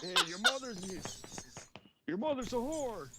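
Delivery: put-on high old man voice; South Park grandpa. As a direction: beg like a cartoon grandpa. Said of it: "That's exactly the video clip".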